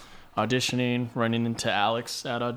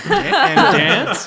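confused; 12 semitones higher, 8 dB louder, much slower than usual. Retold or imitated. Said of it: "I was like".

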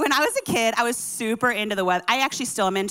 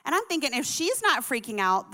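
usual speaking voice

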